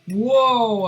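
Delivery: loud, high, startled whoa. Real, not acted. Gamer nearly dying in the fight, real startled whoa. Near death in the game.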